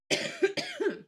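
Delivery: sudden harsh coughing fit. Real, not acted. Vlogger chokes mid-review, sharp coughing fit. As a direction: cough hard, choking on it. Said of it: "I'm choking and this hurts".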